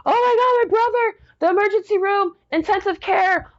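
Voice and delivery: very high, squealed panic on the phone; high-pitched mock hysteria; imitated hysterical screaming. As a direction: act out a relative wailing frantic news. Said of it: "she's screaming, like, hysterical on the phone".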